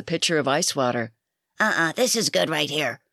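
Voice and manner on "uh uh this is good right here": a light growl from a tiny tough woman; understated growl of a small, hard drinker. Acted. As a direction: mildly growl it, keeping it short. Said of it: "growled his customer".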